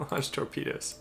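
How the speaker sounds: high, soft words through laughter; laughing order. Real, not acted. Player ordering a hopeless attack on a battleship, laughing. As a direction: give the order while cracking up.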